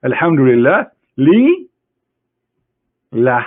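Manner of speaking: "Alhamdulillah li" with "li" stressed and rising, then "lah" apart; split-up Alhamdulillah. Shown as a demonstration. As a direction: break the word, leaning on "li". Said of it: "Li in here mean two".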